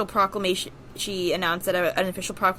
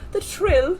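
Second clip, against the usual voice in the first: high trill; 11 semitones higher, 5 dB louder than usual. Shown as a demonstration. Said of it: "You gotta get the little".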